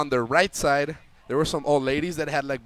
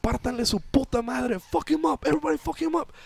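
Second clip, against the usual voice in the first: very high rallying shout; rallying yell; 11 semitones higher, faster than usual. Retold or imitated. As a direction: rouse the whole bus to attack.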